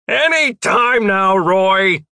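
loud, high, drawn-out yell; high, stretched-out holler; impatient shout. A narrator's reading. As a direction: shout it impatiently while passing. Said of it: "Jeff shouted as he passed".

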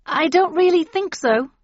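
high, loud firm refusal; polite refusal. A narrator's reading. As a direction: turn them down firmly. Narrator refusing witches.

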